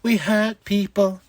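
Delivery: slow, heavy mock threat; hammed-up wrestler catchphrase; slow menacing catchphrase. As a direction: lean into the catchphrase. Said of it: "the Hurt Syndicate".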